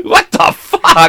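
high, drawn-out squeal of disbelief; incredulous outburst. Real, not acted. Host appalled by co-host's joke, incredulous outburst. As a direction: stretch it out slowly, voice way up.

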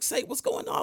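high, light friendly voice for the friend; friend's voice. Retold or imitated. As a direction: pitch way up as the concerned friend. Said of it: "so he's like".

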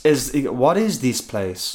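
slow, subdued quoted line; muted Mario line. Retold or imitated. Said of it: "it's so muted".

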